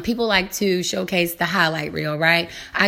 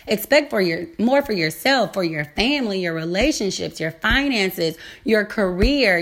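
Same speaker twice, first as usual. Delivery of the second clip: ordinary delivery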